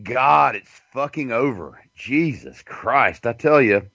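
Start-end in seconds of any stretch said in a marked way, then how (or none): none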